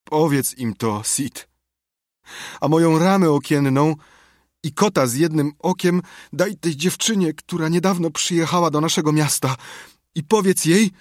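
high, plaintive last wishes; deathbed lament. A narrator's reading. Tomek dictating his last will to Sid, plaintive.

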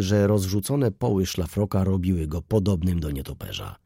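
ordinary delivery

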